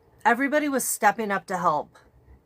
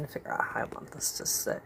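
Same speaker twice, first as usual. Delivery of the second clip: hushed whisper to herself; 9 semitones lower, 11 dB quieter, faster than usual. Real, not acted. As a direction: breathe the words out unvoiced, fast. Muttering to self while setting up.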